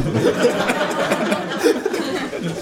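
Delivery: high airy giggle; kangaroo cackle. Acted. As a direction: let the kangaroo snicker. Kangaroo laughs.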